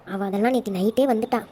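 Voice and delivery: ordinary delivery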